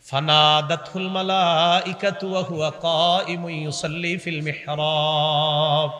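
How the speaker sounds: slow, even melodic chant; drawn-out sung recitation; Quran recitation. Acted. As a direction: intone the Arabic with very long endings.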